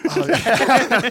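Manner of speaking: high, loud, hammed-up eagerness; mock eager yes. Accepting the invite to her house, hammed-up eager. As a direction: jump at the offer, overplaying it.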